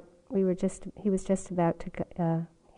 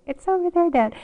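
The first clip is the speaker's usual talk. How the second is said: put-on high little-kid voice, louder than usual; 8 semitones higher, 6 dB louder than usual. Repeating the kid line to Dad, high and childlike. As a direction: chirp it out like a small child. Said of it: "in my little kid voice".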